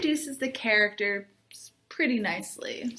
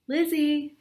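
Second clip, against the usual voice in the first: a bright call across the cafeteria, higher; 4 semitones higher than usual. Acted. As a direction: call her name across the noisy room. Friend calling across cafeteria.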